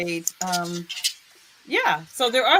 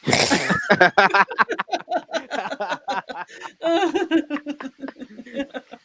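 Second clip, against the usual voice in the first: laughing; 4 semitones higher than usual. Real, not acted. Long laughter after a joke.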